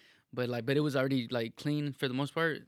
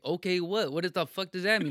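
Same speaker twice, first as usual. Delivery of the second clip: higher, louder confused challenge; baffled retort; 6 semitones higher, 7 dB louder than usual. Retold or imitated. Retelling his reply to friends' warning, loud and baffled. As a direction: replay not getting it, loudly. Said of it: "I didn't know what the fuck it meant".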